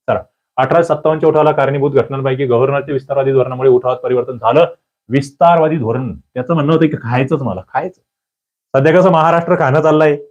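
normal talking voice

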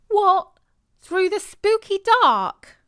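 high, loud shocked outcry; alarmed protest. A narrator's reading. Boy balks at walking in the dark, loud and shocked. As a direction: yelp it out in disbelief. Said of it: "said Jeremy".

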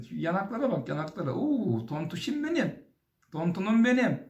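put-on high, gushing baby talk; playful auntie-style cooing; doting cheek-pinching coo. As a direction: fuss over adorable chubby cheeks, pitch climbing. Cooing over chubby cheeks.

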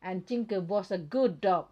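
very slow, loud, each word spelled out; loud and slow, like reading to a toy; slow clear English. Shown as a demonstration. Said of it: "ต้องพูด ภาษาให้ชัดนะคะ".

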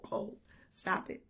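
soft, laughing rebuke; hushed correction. Retold or imitated. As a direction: gently wave the idea away. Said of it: "I said".

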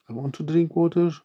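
ordinary talk, nothing marked

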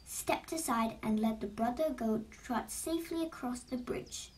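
usual speaking voice

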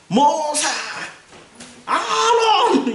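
high, drawn-out mock holler of names; confrontational yell. Israelites confronting their leaders, mock angry yell. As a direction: call out leaders like an aggrieved crowd. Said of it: "이러고 따지는 사람들".

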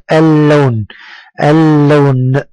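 "اللون" with a long, held l, al-lawn; doubled lam. Shown as a demonstration. Teacher models a sun letter, l doubled in "اللون". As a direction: stretch the double l slowly in "اللون". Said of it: "وكأننا ننطقها مرتين".

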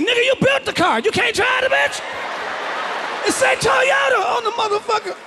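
high, shouted mock abuse at a driver; road rage rant. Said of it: "I don't care if you Chinese".

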